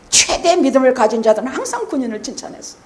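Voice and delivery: normal talking voice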